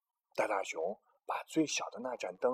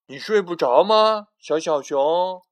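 much louder, higher question; loud call; 5 semitones higher, 14 dB louder than usual. Acted. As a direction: call out from the chair. Big Bear asks little bear.

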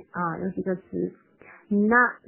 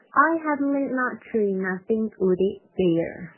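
line run together more quickly; quicker demo. Shown as a demonstration. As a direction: read it through in a connected flow. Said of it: "我同样是先快再慢这块".

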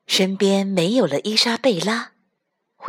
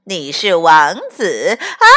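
mocking laughter; 8 dB louder than usual. Frogs mock with laughter.

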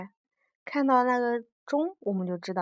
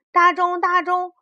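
high, loud, slow call; child calling out; 8 semitones higher, 7 dB louder than usual. Acted. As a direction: call up to the big clock. Child calls the big clock.

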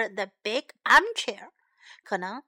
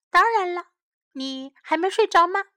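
lively; 7 semitones higher, 6 dB louder than usual. Acted. Stella answers sleepy Sam.

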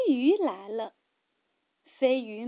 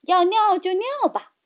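impatient; 5 semitones higher, 4 dB louder than usual. A narrator's reading. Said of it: "很不耐烦地说".

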